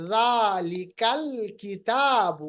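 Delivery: slow chanted ذالک الکتاب; recitation demo. Shown as a demonstration. Teacher demonstrating hamzat ul wasl, slow chant. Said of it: "یہ آپ قاعدہ کلیہ یاد رکھیے گا".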